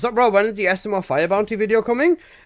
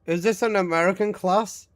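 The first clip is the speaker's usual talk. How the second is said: ordinary talk, nothing marked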